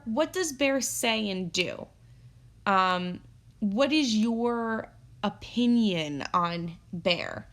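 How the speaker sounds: ordinary delivery, nothing marked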